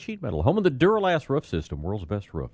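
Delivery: bright, lifted sponsor read; upbeat radio sponsor read; announcer read. Acted. Live sponsored forecast intro.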